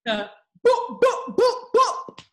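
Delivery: high, loud "boop boop" knocks; playful knocking noises; imitated door knocking. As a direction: mimic someone banging on your door. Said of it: "on my front door".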